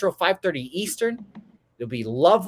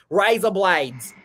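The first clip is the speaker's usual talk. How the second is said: put-on slow, drawn-out Australian accent; imitated Australian accent; 2 semitones higher, slower than usual. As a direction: stretch it out in mock Australian. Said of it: "He's a great accent".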